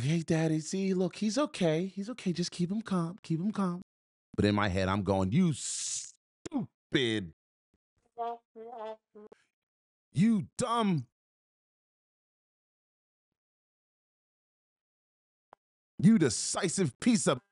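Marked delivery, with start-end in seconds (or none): 0.0-3.8 high-pitched, light soothing voice; put-on reassurance. Calming a father while holding his wounded child, high reassuring voice. 5.3-17.4 high, strained outburst broken by long pauses; acted inner fury. Raging inwardly while holding the shot baby, acted fury. Acting out furious inner thoughts.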